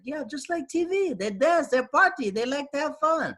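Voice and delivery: very high mock voice of the guide; hammed-up guide explaining that they party; tour guide. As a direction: pitch way up imitating the guide. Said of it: "He's like".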